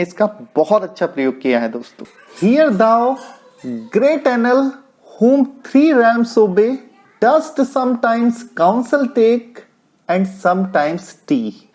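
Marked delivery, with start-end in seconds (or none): 2.4-11.7 slow, measured verse reading; poem recitation. Acted. Teacher reads a Pope couplet aloud to students, slow and measured. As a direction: give each line of the couplet its own weight.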